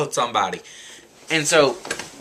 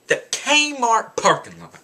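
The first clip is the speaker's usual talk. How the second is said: KMART pitched high and drawn out; slow, disbelieving repeat with a high KMART; incredulous emphasis; 7 semitones higher, slower than usual. Real, not acted. Rider stunned by the bus stopping there, incredulous emphasis.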